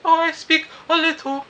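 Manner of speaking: mock her in a high giggly voice; girly voice. The Japanese girl agreeing they're a good team, in a high squeaky voice. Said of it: "She's like".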